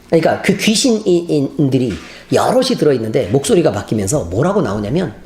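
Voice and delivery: usual speaking voice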